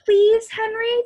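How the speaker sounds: eager high plea; begging. Acted. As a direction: plead with her brother eagerly. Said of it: "begged Jessie".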